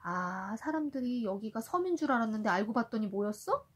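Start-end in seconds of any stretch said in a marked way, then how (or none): none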